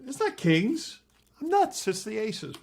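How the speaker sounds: high, loud flustered outburst; mock exasperation. Magician correcting his own trick, comically flustered. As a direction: burst out as if suddenly realizing the blunder.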